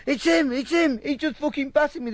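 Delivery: high-pitched imitated yelp to police; frantic mock accusation. Beaten man telling coppers who hit him, frantic mock yelp. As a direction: point out the attacker frantically, mimicking.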